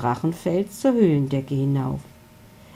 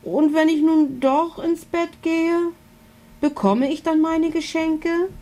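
pleading little dragon; 12 semitones higher than usual. A narrator's reading. Milo pleads for presents.

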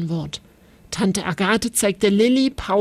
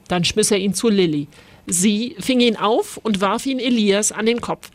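plain reading voice

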